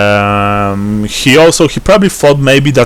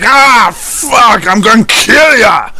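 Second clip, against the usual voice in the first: furious shout; 11 semitones higher, slower than usual. Acted. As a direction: yell it with rage. GM voices enraged enemy.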